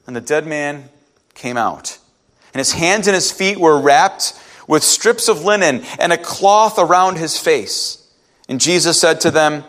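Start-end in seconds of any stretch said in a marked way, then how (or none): none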